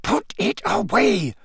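high, slow, snarling order; angry snarl in character. A narrator's reading. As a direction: order him off with drawn-out menace. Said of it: "snarled Snape".

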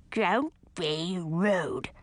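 put-on slow prim scold from an old skull. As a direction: drag it out, gently scolding.

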